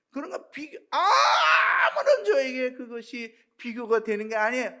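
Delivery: a preacher breaking into a tearful shout. Real, not acted. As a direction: preach it through tears at full force. Preaching climax, crying out.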